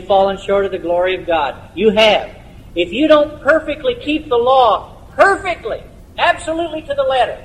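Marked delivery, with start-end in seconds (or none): 2.8-7.4 high, insistent pulpit warning; impassioned preaching. Real, not acted. Preacher warns congregation of the law's strict demand, high and insistent. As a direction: press the point urgently in a raised pitch.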